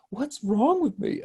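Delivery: high, slow, baffled at himself; mock bewildered. Dad crying at Toy Story, asking himself, high and slow. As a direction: ask it with mock disbelief. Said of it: "And I'm like".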